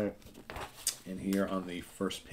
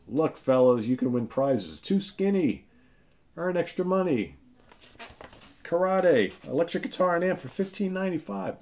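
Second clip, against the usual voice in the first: much higher, excited huckster voice; hammed-up ad pitchman; 10 semitones higher, 5 dB louder than usual. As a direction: sell it like a corny vintage ad. Reads old comic ads aloud.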